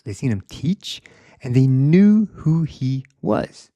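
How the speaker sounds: ordinary talk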